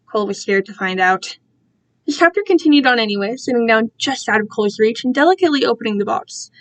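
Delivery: ordinary delivery, nothing marked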